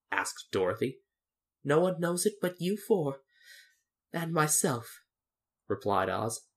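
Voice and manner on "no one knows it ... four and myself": lightly voiced little man, pitched up; mildly sheepish little man; confiding Oz. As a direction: admit the secret, pitched high. Little man Oz confides his secret.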